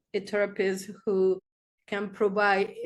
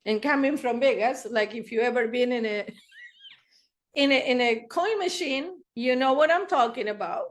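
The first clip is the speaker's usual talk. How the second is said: ordinary talk, nothing marked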